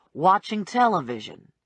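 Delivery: plain reading voice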